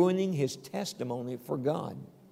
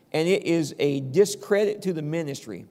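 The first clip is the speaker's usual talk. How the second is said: ordinary delivery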